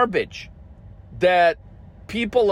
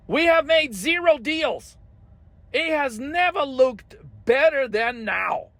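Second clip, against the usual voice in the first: much higher, chirpy sarcastic boast; mock bragging; 3 semitones higher than usual. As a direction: brag absurdly like a spokesman.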